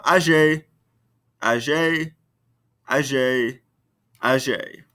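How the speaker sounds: every "Âgé" ending on the same "ay" vowel; identical pronunciation. Shown as a demonstration. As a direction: repeat "Âgé" with its final E sounded. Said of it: "Same pronunciation all the way across".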